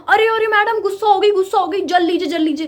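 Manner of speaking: high, loud teasing put-on voice; mocking coworker. Coworker taunting the angry narrator, high loud mockery. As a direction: mimic the pest taunting her. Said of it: "भाई ये बंदा".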